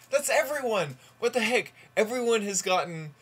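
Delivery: loud, high outburst of disbelief; baffled outcry at a repeat result; exasperated disbelief. Real, not acted. Same dice result yet again.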